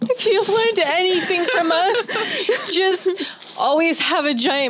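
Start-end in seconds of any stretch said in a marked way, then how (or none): 0.0-3.1 high, slow, barely getting it out; laughing. Real, not acted. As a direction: force the words through real laughter. Host cracking up during sign-off advice.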